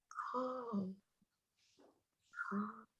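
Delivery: hushed and soothing, almost breathed; softly settling a nervous dog; soothing calm. Retold or imitated. Chaplain soothing the therapy dog, hushed.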